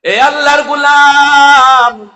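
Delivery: high, loud chanting sermon delivery; ringing, high-pitched lament to a crowd; impassioned preaching. Real, not acted. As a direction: preach at full force, pitched far up. Preaches at full force.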